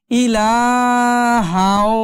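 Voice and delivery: "ইলাহাও" drawn out, ending in a nasal w; merged ghunna. Shown as a demonstration. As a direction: slowly hum the end of "ইলাহাও". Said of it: "হাও হয়ে যাবে".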